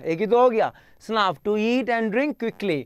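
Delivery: ordinary talk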